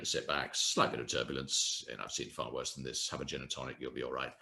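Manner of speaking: low, soft, reassuring captain; calm pilot. Retold or imitated. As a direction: soothe nervous passengers through the turbulence. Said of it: "You need a pilot to say".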